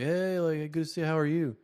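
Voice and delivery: high-pitched awkward small talk; put-on teen voice. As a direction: imitate an awkward teenager greeting a crush. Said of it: "I'm gonna give them the voices that I heard".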